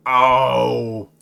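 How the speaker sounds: loud "oh" of a fallen toad; drawn-out groan after a crash landing; frog's crash groan. Acted. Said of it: "As you hear it go".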